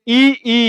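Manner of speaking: ই said short, then ই held longer; short and long i contrasted. Shown as a demonstration. Teacher to class: ই said short, then long. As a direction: contrast the two ই sounds by length. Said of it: "ইটা কম সময় উচ্চারণ হয়".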